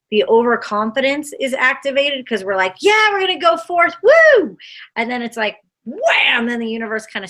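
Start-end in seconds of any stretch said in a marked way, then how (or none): 2.8-4.6 high, loud cocky whoop; mock cheer. Ego cheering itself on, mock triumphant whoop. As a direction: hype yourself up like you can't lose.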